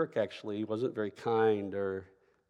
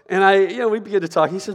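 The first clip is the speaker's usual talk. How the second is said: usual speaking voice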